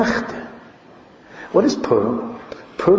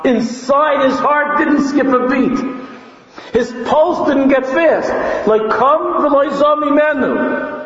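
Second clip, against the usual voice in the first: high, insistent sermon cry; impassioned high-pitched preaching; full-force preaching; 12 semitones higher than usual. Real, not acted. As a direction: drive each point home on a high pitch.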